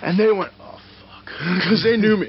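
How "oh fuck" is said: whispered mock dread; retold hushed dismay. Fans who knew him, retold whispered dismay. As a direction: whisper it like a worried onlooker.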